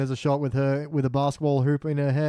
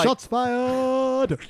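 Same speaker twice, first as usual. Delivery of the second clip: high, drawn-out shout; mock alarm call; 9 semitones higher than usual. Podcaster reacting to a jab at ESPN, mock alarm shout. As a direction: yell it out in hammed-up alarm.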